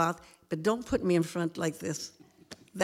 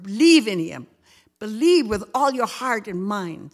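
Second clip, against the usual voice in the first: rising, louder preacher's exhortation; earnest preaching; 4 semitones higher, 4 dB louder than usual. Acted. Grandmother urging listeners to stay faithful, preaching. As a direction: press each promise home like a sermon.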